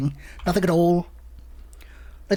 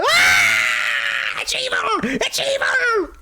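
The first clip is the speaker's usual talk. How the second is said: panicked scream; 11 semitones higher, much slower than usual. Real, not acted. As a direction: scream that it's evil. Screams at the evil water.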